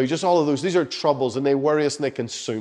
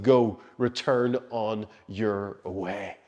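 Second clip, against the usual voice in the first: soft, low, very slow command; speaking hope; 5 semitones lower, 5 dB quieter, much slower than usual. Retold or imitated. The Lord sending Elijah back on his way, softly speaking hope. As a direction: send them off gently, word by word. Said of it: "He speaks to him and he speaks hope".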